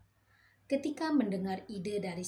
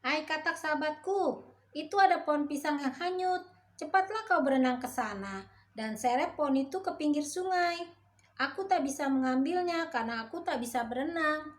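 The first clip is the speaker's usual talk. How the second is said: much higher, louder pushy monkey voice; the monkey urging the frog to swim, higher and louder; bossy monkey; 8 semitones higher, 6 dB louder than usual. A narrator's reading. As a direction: order your friend into the river.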